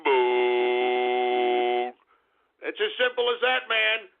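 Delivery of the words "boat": lower, drawn-out taunt of ham boat; mocking jeer. Jeering at a scooter rider in passing, low mock taunt. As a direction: sneer it under your breath while walking past. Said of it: "pass by 'em and say".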